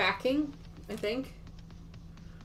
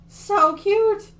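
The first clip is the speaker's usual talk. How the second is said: "So cute" squealed much higher and louder; squealing cute; 10 semitones higher, 10 dB louder than usual. Real, not acted. Gushing over the finished gnome block.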